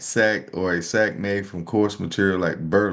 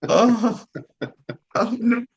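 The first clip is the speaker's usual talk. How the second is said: high, laughing oh no; laughing squeal; 13 semitones higher, slower than usual. Real, not acted. Host cracking up at the bet, squealing with laughter. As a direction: break into a high giggle.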